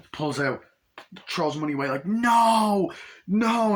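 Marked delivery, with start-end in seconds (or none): 2.1-3.8 high, loud drawn-out wail; acted despairing yell; anguished shout. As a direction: cry out as it is lost. Acting out money blowing away.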